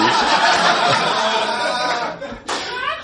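a long, high burst of laughter; cracking up at the memory. Real, not acted. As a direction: break up laughing at it. Laughs at his own story.

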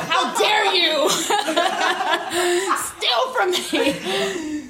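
high, drawn-out mock outrage through laughter; laughing, slowed-down indignant duck. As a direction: play the duck demanding its egg back, laughing. Duck reclaiming its property.

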